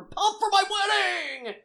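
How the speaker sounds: loud, high, drawn-out burst of excitement; super pumped. Real, not acted. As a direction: belt it out, hugely pumped. Gushing about being thrilled for wedding.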